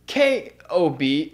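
usual speaking voice

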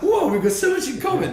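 high, loud gushing with a laugh; mock-excited chatter with yourself; excited clone. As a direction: gush like meeting your twin. Imagined chat with own clone.

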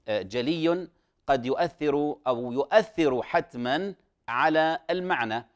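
normal talking voice

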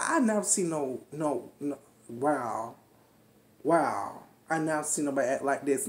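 low, softened stunned "wow"; stunned disbelief. Retold or imitated. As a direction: mimic a guest quietly taken aback. Retells guest's stunned reaction.

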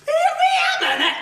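squeaky, high-pitched double take; cartoonishly high outburst; sudden high objection. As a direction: pipe up high as the insult sinks in. Sudden realisation of insult.

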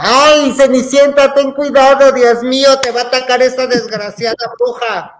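high, loud panicked warning; retold shouting. Imitating herself as a child warning Cenicienta about the witch, shouting. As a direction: yell it as if warning the heroine through the speaker. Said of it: "Y, y yo gritaba".

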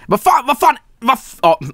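high exasperated Swedish cursing; frustrated outburst. Real, not acted. Gamer swears in Swedish at a confusing map. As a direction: blurt the curses up high in frustration.

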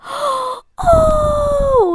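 high gasping shout; surprised gasp. Real, not acted. YouTuber gasping as a rare pet hatches, high.

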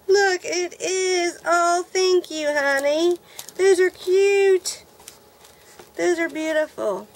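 high, loud and slow, savouring each word; excited gushing. Real, not acted. Vlogger thanking her friend Rosita, slow delighted coo. Thrilled thanking gift sender.

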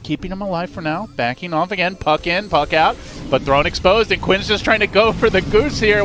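fast, rising live commentary; play-by-play. Acted. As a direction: call the fight as it happens. Live play-by-play of a team fight.

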